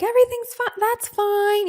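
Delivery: breezy mom. Retold or imitated. Daughter imitating her mom who is calm, breezy. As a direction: lightly imitate an unbothered parent. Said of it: "who's very, like".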